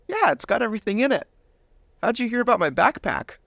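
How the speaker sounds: put-on proud kid showing off his gear; eager teen. Teen self replying eagerly.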